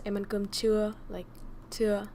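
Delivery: chưa with tone rising upward; "chưa" said with a rising tone, as a question. Shown as a demonstration. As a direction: lift the tone on chưa. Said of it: "your tone goes upwards".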